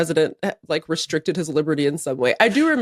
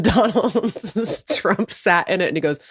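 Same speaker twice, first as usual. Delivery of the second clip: ordinary talk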